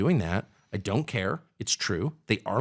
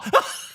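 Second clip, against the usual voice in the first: laugh. Real, not acted. Laughs at own mock scream.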